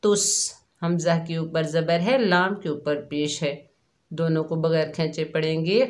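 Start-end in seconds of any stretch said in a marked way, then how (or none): 0.0-0.5 तुस with a sharp hissing स; whistled seen. Shown as a demonstration. Teacher showing students how seen whistles, sharp hiss. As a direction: say तुस with a hard, whistling स sound. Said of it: "सीन को सख्ती और सीटी से अदा करेंगे".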